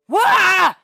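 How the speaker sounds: high, loud startled scream; boy's scream. Acted. A boy waking to a dragon, screaming high and loud. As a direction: shriek in terror. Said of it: "he screamed".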